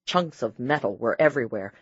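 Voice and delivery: ordinary delivery, nothing marked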